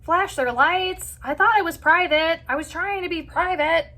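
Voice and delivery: high-pitched, mildly flustered protest; imitated embarrassed mom. Mom asking kids if they saw her, embarrassed. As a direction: play a mom mortified at being seen. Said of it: "potentially embarrassed 'cause she's like".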